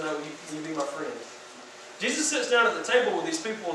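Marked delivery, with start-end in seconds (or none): 0.0-1.1 softer, lower gentle plea; soft tender ask. Acted. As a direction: ask quietly, like offering friendship. Jesus repeating the ask.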